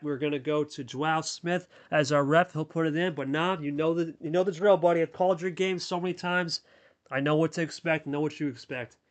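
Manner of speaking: usual speaking voice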